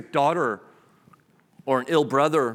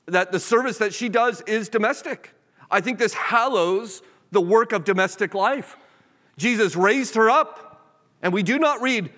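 raised, driving preacher's cadence; full-force sermon delivery; fervent preaching; 7 semitones higher than usual. Acted. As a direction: preach it with rising conviction.

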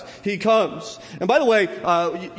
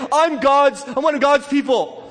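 high, loud, smug self-righteous boasting; hammed-up holier-than-thou brag; mocking the proud; 7 semitones higher, 5 dB louder than usual. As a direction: lampoon a puffed-up believer bragging, with a laugh. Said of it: "people who go around saying".

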